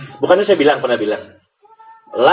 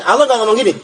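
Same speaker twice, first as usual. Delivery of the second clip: ordinary talk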